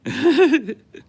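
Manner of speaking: high, loud burst of laughter; real laugh. Real, not acted. Laughing off the co-host's loser jab, big real laugh.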